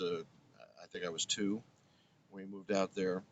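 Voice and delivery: normal talking voice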